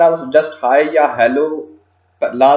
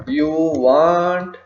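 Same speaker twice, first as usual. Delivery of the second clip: drawn-out words with a long pause; slow dictation; much slower than usual. Shown as a demonstration. Tutor starting another point in the message, slow dictation. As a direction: say it word by word as you type.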